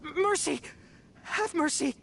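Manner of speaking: high, frantic begging for mercy; panicked pleading. Acted. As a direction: beg in a panic. Cornered thief begs for mercy.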